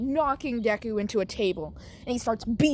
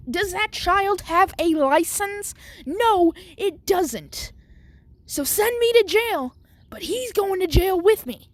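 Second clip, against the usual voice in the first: indignant retort; 9 semitones higher, 4 dB louder than usual. Acted. Principal lectures angry mom.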